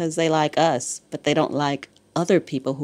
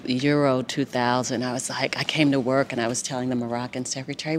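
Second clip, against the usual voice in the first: ordinary talk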